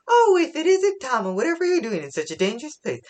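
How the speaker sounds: high-pitched, gushing mock motherly fussing; Big Mom. Big Mom in mother mode greeting Tama, high motherly fussing. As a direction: fuss over a child, starting very high and loud, then rushing. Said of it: "she goes into her mother mode".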